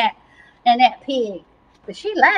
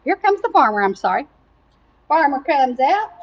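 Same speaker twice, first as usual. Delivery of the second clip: plain reading voice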